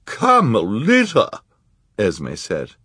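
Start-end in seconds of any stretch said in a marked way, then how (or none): 0.1-1.4 high, loud, slow shocked cry. A narrator's reading. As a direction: gasp her name in shock. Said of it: "expression of shock".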